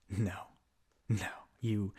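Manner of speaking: whispered, close and cold; menacing whisper. Acted. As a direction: breathe it like a threat. Villain hissing at the hero.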